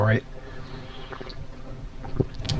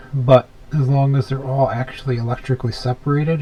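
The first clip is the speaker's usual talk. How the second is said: ordinary delivery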